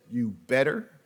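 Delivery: a no-nonsense inner voice telling him to hush; stern warning. Retold or imitated. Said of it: "no, he said".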